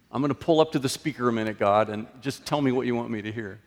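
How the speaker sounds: rushed, casual order to God; hurried drive-thru prayer. Retold or imitated. Preacher mimics a believer rushing God, fast and casual. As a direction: rattle it off like a fast-food order.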